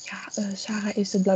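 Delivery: hushed, breathy gossip whisper; retold whisper. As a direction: whisper it like classmates gossiping behind her. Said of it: "ik hoorde hun dus fluisteren van".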